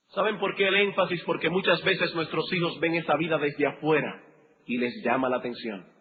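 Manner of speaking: ordinary delivery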